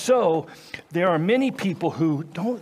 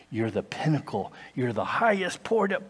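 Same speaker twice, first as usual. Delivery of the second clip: ordinary talk, nothing marked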